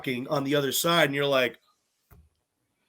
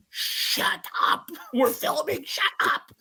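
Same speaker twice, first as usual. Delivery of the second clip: whispery mock telling-off; voiceless, urgent imitation of a shushing; imitated crew hushing; 11 semitones higher, slower than usual. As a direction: hush them urgently, as crew would mid-shoot. Crew hushing contestants during filming.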